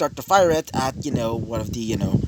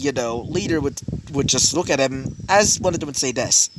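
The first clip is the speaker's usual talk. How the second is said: ordinary delivery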